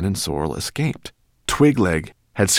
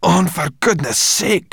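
higher, louder, slow exasperation; complaining; 11 semitones higher, 6 dB louder than usual. A narrator's reading. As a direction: whine in exasperation. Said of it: "complained Sorrel".

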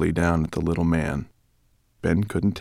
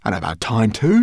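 spat; 5 semitones higher, 8 dB louder than usual. Acted. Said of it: "she spat".

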